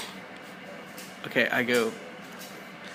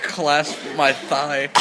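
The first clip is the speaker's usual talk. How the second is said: ordinary talk, nothing marked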